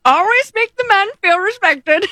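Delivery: high, put-on old-timey announcement; comic old-timey tip reader. Shown as a demonstration. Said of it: "say something funny in an old-timey voice".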